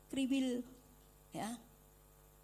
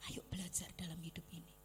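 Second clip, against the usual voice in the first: softer fast hushed whisper; 6 semitones lower, 10 dB quieter, faster than usual. Real, not acted. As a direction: draw them close with a whisper. Sudden hush in the sermon.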